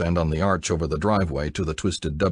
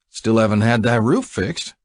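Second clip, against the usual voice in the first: drawl; 5 semitones higher, 4 dB louder than usual. A narrator's reading. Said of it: "Buck drawled".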